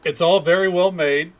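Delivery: feigned praise. Pretend. Feigned praise, then the real verdict.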